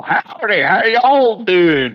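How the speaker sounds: high, slow caricature Texas drawl; hammed-up Texan greeting. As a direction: holler it like a cartoon Texan, drawn out. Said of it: "we're not all".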